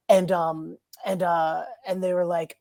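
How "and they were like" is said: usual speaking voice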